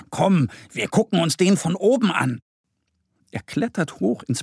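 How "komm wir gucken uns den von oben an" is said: higher, lively invitation from a little bear; calling out. A narrator's reading. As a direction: call your friend along, pitched up. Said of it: "ruft Mo". (munter rufend)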